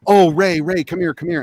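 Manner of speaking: loud, high eager call of his name; mock excited hail across the lot. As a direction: wave him over with a bright call. [name] calling him over.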